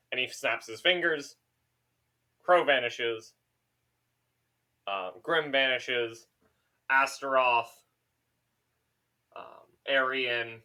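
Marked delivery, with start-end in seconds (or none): none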